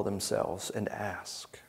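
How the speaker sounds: dropped to a whisper. Real, not acted. Preacher to congregation, on the Pharisees not asking, whispered. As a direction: let the last words fall into a whisper.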